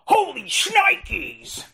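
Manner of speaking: high, slow shouted catchphrase; Chris Farley impression. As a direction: yell it like Tommy Boy. Episode title, Chris Farley callback.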